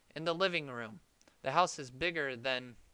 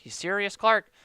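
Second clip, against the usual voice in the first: higher, loud rising question; excited disbelief; 5 semitones higher, 9 dB louder than usual. Acted. Cousin asking Clark about Santa, loud and excited. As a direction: ask it thrilled and unbelieving.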